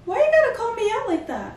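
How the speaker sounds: high, fast, laughing complaint; playful high-pitched protest. Real, not acted. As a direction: squeal back at the teasing. Teased friend protests, laughing.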